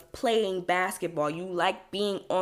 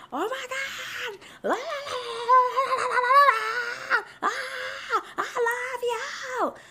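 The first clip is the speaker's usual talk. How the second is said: very high-pitched mock concert screams; imitated screaming fans; slower than usual. Crowd at a concert adoring the singer, imitated screaming. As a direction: squeal like an adoring crowd, drawing words out. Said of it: "I'm seeing people screaming".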